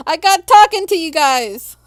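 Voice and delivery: high, loud, laughing blame; playful loud laughing accusation; laughing exclamation. Real, not acted. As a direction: blurt it through laughter.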